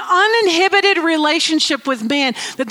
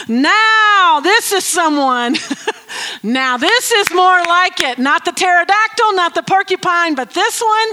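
louder, higher thrilled exclamation; delighted Adam; 5 semitones higher, 4 dB louder than usual. Pretend. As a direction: gush like a man who finally found the one. Adam delighted at meeting woman.

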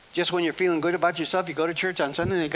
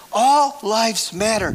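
slow, loud, high proclamation; preaching at full force; 6 semitones higher, 8 dB louder, much slower than usual. Real, not acted. Preacher proclaiming to the congregation, slow and loud. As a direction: lean on each word like a declaration.

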